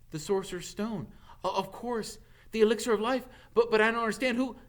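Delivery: high, loud, rushing stammer; flustered boy. A narrator's reading. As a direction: tumble out, growing alarm. Harry stammers, rising alarm.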